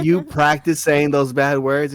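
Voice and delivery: loud, slow, incredulous question; can't believe what he's hearing; loud disbelief. Retold or imitated. Father asking daughter about practised swearing, loud and slow disbelief.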